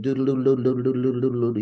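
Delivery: doodle chanted in an unbroken run; over and over. Retold or imitated. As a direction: repeat doodle continuously like a practice exercise. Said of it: "Say it over and over".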